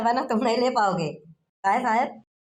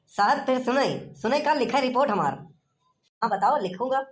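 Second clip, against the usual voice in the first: ordinary talk, nothing marked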